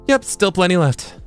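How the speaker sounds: high, earnest character read; upbeat helper character. Acted. Voices a game character.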